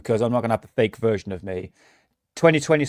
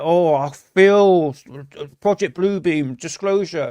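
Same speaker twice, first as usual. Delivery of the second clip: slow, higher dreamy parody voice; mocking believer; 3 semitones higher, slower than usual. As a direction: drag it out like a starry-eyed believer. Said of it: "They're like".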